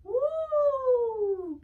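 long, high "woo"; loud, high sustained whoop; cheering whoop. Real, not acted. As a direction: let out a long celebratory woo. Cheer as scarf is tossed.

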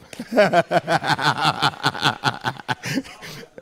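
long, high run of chuckles; helpless rattling laugh; real laughter. Real, not acted. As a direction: crack up at your own story. Laughs at his own story.

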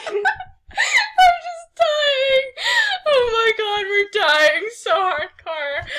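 helpless laughing, drawn out and slow; gasping the words through a laughing fit; real laughter. Real, not acted. Reader breaking down over the fanfic, laughing helplessly.

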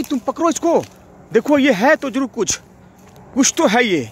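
shouting after something unseen in the dark; excited shouting. Real, not acted. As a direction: chase it down, yelling at it to stop. Chases the presence, shouting.

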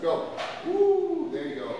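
ordinary talk, nothing marked

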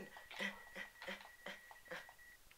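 whispered nervous laugh; soft breathless nervous laugh; breathy laugh. Real, not acted. After fleeing.

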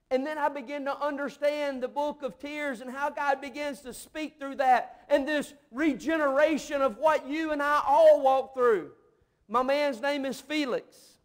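full-voiced pulpit storytelling; impassioned preaching. Real, not acted. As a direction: preach it with rising fervour, loud and urgent.

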